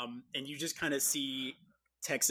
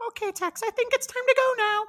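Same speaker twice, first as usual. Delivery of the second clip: high, loud, quick bird voice; tiny bird hurrying its friend along; little bird; 10 dB louder, faster than usual. Acted. Little bird calls Tex.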